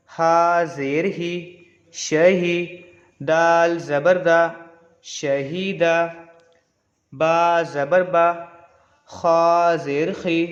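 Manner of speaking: slow, measured letter-by-letter recitation; Qaida-style drill, evenly paced; spelling drill recitation. Shown as a demonstration. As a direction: draw out each letter name, then join the syllables.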